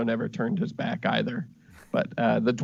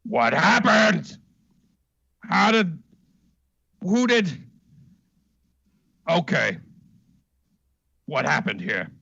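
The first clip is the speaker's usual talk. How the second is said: a puzzled reptile asking what happened; confused lizard folk; 5 semitones higher, 4 dB louder than usual. Acted. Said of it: "the lizard folk seems confused and says".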